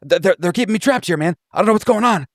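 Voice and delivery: babbling in terror to get loose; freaking out. Acted. Trapped man Vega panicking at the strangers, freaking out. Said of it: "he's like, ... he's just freaking out".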